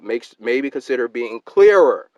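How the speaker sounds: loud and slow, heavy stress on "clearer"; all-caps shout. Shown as a demonstration. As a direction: shout it slowly like a message typed in caps. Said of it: "in all caps".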